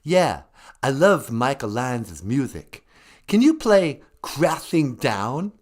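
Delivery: prank caller pretending to be a rock star. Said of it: "It's me, [name] of Kiss".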